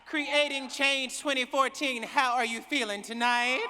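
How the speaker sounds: warm-up call, projected to the hall; calling out to crowd. Real, not acted. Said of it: "I don't know if I believe you".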